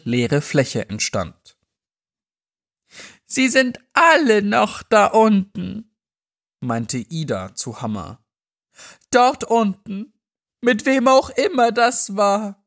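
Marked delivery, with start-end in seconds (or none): 3.2-6.0 put-on higher, slow, haunted old woman voice; frail old woman. Old Ida telling Hammer. 9.1-12.6 put-on higher, slow, haunted old woman voice; frail old woman. Old Ida telling Hammer.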